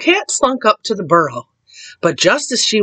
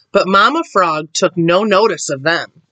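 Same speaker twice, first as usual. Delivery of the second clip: ordinary delivery, nothing marked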